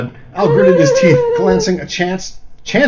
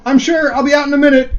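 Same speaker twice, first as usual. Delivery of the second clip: put-on strained young man; 10 semitones higher, 5 dB louder than usual. Al voiced, strained and high.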